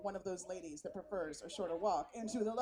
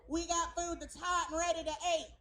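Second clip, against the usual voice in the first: simple Southern voice; 5 semitones higher, 3 dB louder than usual. Retold or imitated. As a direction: draw out the words in a Southern accent. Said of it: "a simple Southern voice".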